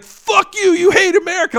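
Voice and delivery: high, loud mock-crying outburst; tearful outrage, mocked. Host mocks free-speech hypocrites seeing a flag burned, high outraged yell. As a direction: yell it as a hammed-up, hurt patriot. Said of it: "they would cry those tears".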